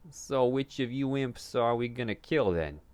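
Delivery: high, loud suspicious question. A narrator's reading. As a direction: demand it with suspicion. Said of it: "Keldorf asked suspiciously".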